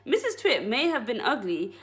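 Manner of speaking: plain reading voice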